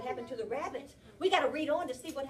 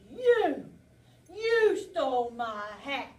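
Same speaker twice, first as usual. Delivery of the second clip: loud, accusing bear voice; angry bear; 2 semitones higher, 8 dB louder, much slower than usual. Acted. Teacher reading to class, the bear accuses: loud angry. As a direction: point the finger, furious at the thief.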